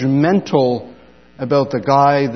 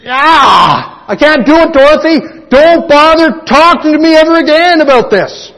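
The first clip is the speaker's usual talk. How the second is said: high, loud hammed-up refusal; exasperated shout; 11 semitones higher, 7 dB louder than usual. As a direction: yell the refusal in mock panic. Final refusal to Dorothy.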